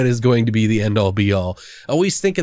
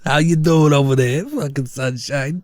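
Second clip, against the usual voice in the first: New York accent. Real, not acted. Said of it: "I can't help talking like this".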